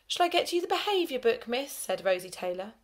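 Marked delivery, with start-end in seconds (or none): 0.1-1.7 high, eager sugary offer; smug helpful. A narrator's reading. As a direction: sound oh so helpful. Tell-tale offering to help.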